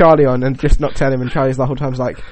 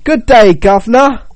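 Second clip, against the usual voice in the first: higher, louder mock posh British; a guy mimicking his friend's British accent; 7 semitones higher, 6 dB louder, slower than usual. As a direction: tip the hat, good day governor in a posh English accent. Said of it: "replicate your accent".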